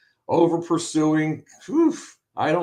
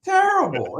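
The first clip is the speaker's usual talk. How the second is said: emphatic terrible. Real, not acted. Co-host slamming the biased commentator, "Terrible" pitched way up. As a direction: repeat "Terrible" much higher, with exasperation. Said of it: "It was terrible".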